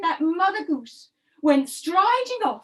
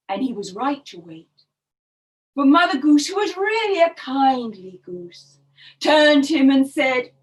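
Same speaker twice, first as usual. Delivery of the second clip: ordinary delivery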